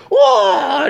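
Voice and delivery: high startled whoa; mock whoa. A wrestler yelping as he goes down, high whoa. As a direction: yelp it out. Retold cry in match.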